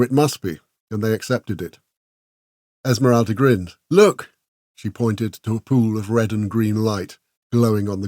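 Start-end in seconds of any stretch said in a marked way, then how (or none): 3.9-4.3 high, bright look!; eager call. A narrator's reading. Esmeralda showing Dax the glowing light, eager call. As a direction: point it out with glee.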